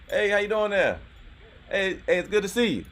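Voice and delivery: high, loud hail to an acquaintance; calling out a greeting. Real, not acted. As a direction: call out brightly to someone passing by. Greets a passing former coworker.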